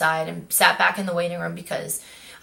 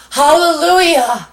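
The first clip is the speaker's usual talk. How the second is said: higher and louder, hammed-up cry of relief; mock hallelujah; 6 semitones higher, 7 dB louder, slower than usual. Patient telling viewers about the nurse's okay, mock hallelujah. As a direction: throw your hands up in joking relief. Relief after eyelash worry.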